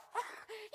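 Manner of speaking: a quick, bright giggle; light laugh. Acted. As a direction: laugh off her grumbling. Juliet laughs at the nurse.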